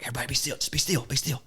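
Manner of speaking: soft, hushed calls to stay still; calm the boys without a sound; hushed retold order. Hunter hushing the boys behind a tree, soft.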